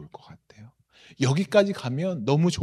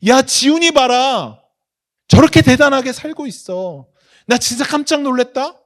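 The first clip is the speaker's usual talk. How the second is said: loud, high proud exclamation; amazed boasting; 10 semitones higher, 11 dB louder than usual. Acted. God bragging to the angels about a believer, amazed boasting. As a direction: call everyone over to admire him, amazed and loud. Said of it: "하나님이 자랑하시겠죠".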